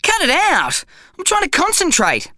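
high, sharp irritated cry; irritated shout. A narrator's reading. Zach, skiing fast, snapping at his bum in irritation. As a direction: snap at the bum to stop.

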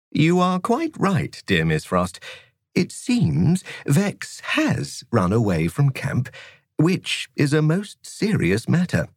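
bright, cheerful gentleman teacher; breezy, good-humoured schoolmaster; jolly teacher voice. Acted. Narrator voices Mister Bambuckle.

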